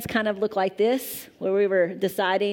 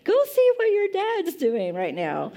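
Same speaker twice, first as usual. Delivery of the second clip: higher, breezy brush-off to her son; mom passing the buck; 9 semitones higher than usual. Retold or imitated. Mother brushing off her son's request, breezy and higher. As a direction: sweetly send the kid to dad. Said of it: "If he says".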